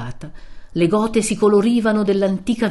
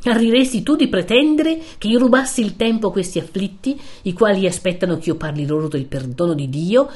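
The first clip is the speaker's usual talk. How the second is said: severe friar; 3 semitones higher, 3 dB louder than usual. Acted. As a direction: rebuke him with mounting severity. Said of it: "con una voce non meno severa". (frate severo, voce alta e dura)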